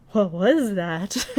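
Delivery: slow, breathless daze; dazed wonder. Retold or imitated. Marius dazed after Grenda's kiss, slow dreamy voice. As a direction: let it float out dreamily.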